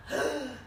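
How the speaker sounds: sharp mock-horrified gasp; storyteller's theatrical intake of breath; dramatic gasp. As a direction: gasp as if the bath is coming. Reacts to dreaded bath.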